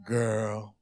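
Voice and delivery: put-on low, drawn-out smooth "Girl"; R&B bass singer. Shown as a demonstration. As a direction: croon it spoken, deep like a slow-jam bridge. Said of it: "usually the bass singer with the cane comes out".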